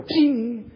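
high-pitched little ping sound; imitated flick noise. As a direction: mimic a spit wad being flicked. Imitates flicked spit wad.

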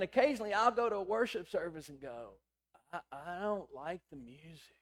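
ordinary talk

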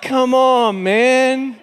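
high, drawn-out mock complaint; exasperated protest. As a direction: whine it in playful exasperation. Exasperated protest to God, acted.

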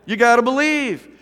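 loud, high rallying call; slogan called out. Retold or imitated. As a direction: proclaim it like a team slogan. Calling out the signs' slogan.

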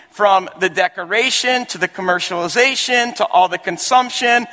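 high-pitched, emphatic sermon delivery; emphatic preaching. Real, not acted. Preacher drives home his point.

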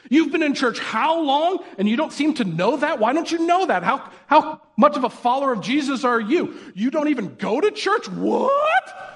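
high, incredulous scolding; mock judgmental churchgoer. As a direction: play a shocked, snooty churchgoer.

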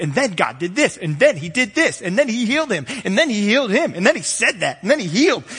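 rapid, excited play-by-play rhythm, pitch climbing; Sports Center host. Retold or imitated. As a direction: rattle it off like a sportscaster. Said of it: "he would have been a Sports Center host".

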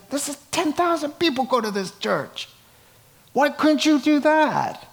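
high exasperated question. Real, not acted. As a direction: rise up in frustration. Exasperated rhetorical outburst.

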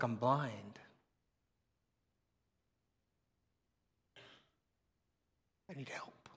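low voice fading to a whisper; acted hushed admission of helplessness; humble plea. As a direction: confess need very quietly. Blind man answers Jesus, voiced softly.

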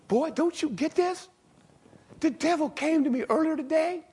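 high, loud exasperated rebuke; exasperated Jesus. Acted. Jesus scolding Peter, high and exasperated. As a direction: scold a stubborn student.

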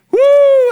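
loud, high, drawn-out "woo"; high celebratory holler from the pulpit; excited whoop. Real, not acted. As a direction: let out a real whoop of delight. Preacher thrilled by his own point.

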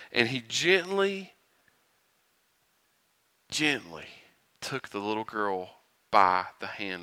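put-on tender hush over the little girl; gentle telling. A narrator's reading. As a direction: ease into it as if touching a sleeping child.

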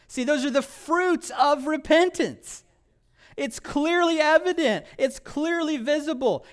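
high, loud preacher's cry; preaching at full force. Real, not acted. Preacher driving home repentance to congregation, loud and high. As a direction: proclaim it to the whole room.

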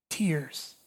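whispered. Retold or imitated. Quoted answer in a hushed punchline.

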